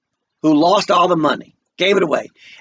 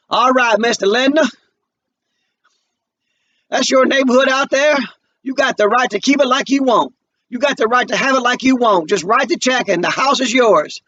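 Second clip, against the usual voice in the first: higher, strained mock politeness to the buyer; forced politeness; 8 semitones higher than usual. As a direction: choke out a polite deal. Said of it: "he's having trouble even doing it".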